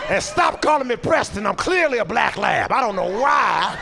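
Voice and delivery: high, drawn-out happy dog; indignant lab at his name. Acted. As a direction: gush like a dog whose owner came home. Dog greeting owner.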